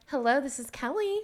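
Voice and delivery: high, pleasant client-call greeting; perky professional phone answer; fake phone voice. Shown as a demonstration. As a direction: put on a happy voice for a client. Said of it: "A fake voice".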